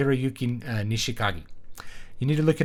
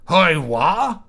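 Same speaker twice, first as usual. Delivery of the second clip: startled yelp, loud; a startled man's loud squawk; 6 semitones higher, 8 dB louder than usual. Acted. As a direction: jump at a voice behind you. Startled man caught off guard.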